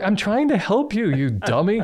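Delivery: high-pitched mock scolding; exasperated pleading. Retold scolding of struggling cat.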